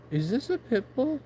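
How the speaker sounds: high, suspicious rising question; sudden worried high voice; wary stranger. Retold or imitated. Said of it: "and go".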